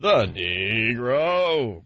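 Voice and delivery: hugely drawn-out, falling vowels; mock professor. Host parroting the professor from the clip, mock ponderous and drawn out. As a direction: stretch it out like a pompous film lecturer.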